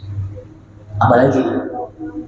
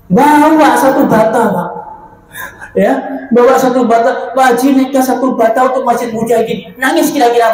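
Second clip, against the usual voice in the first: incredulous mock; 8 semitones higher than usual. Mocking rich man's one brick.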